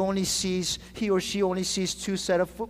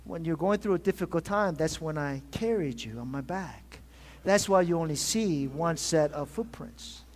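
softer, tender reassurance from God; gentle soft voice; 6 semitones lower, 6 dB quieter than usual. Retold or imitated. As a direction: speak it gently and softly, like comforting a tired child. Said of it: "God responds in a gentle, soft voice".

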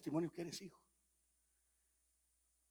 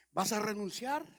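executioner's demand; 8 semitones higher, 10 dB louder than usual. Retold or imitated.

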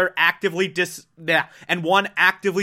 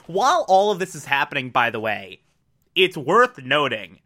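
normal talking voice

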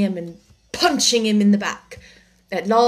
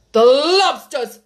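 loud, pitched-up interruption; excited shout; 5 semitones higher, 8 dB louder than usual. A narrator's reading. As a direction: shout it out as the Gryphon. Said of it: "shouted the Gryphon".

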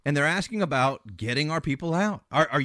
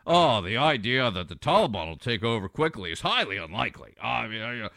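mocking Biden; 5 semitones lower than usual. Host mocks Biden's words.

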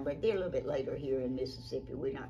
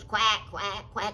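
short, higher quacks; duck quack; 6 semitones higher, 9 dB louder, slower than usual. Shown as a demonstration. Storyteller shows how a duck goes, quacking. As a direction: demonstrate a duck's quacking. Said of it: "but a duck goes".

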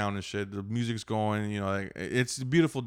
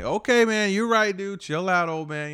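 higher-pitched, humoring brush-off; placating; 9 semitones higher, 4 dB louder than usual. Retold or imitated. Driver humoring the yelling old man, placating. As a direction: agree just to settle him down.

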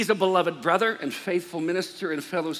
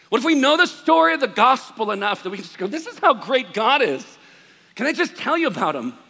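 loud, high, building fervor; fervent preaching; 7 semitones higher, 9 dB louder than usual. Real, not acted. Pastor urging the church to brag about God, loud and fervent. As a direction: preach it with rising excitement.

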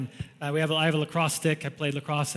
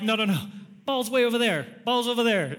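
higher and louder, pointing the dog off; raised, excited call to a confused dog; 8 semitones higher, 4 dB louder than usual. Retold or imitated. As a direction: call out to the dog across the park. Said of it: "And we're like".

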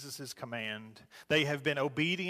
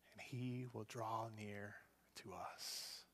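hushed whispered scripture; slow reverent whisper; 5 semitones lower, 18 dB quieter, slower than usual. Acted. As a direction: breathe the words out in a whisper. Preacher whispers scripture close.

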